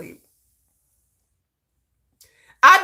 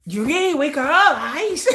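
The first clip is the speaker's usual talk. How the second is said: giggly wake-up call; 7 semitones higher, slower than usual. Retold or imitated. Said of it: "and be like".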